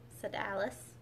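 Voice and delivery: ordinary delivery, nothing marked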